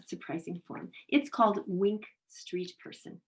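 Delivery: normal talking voice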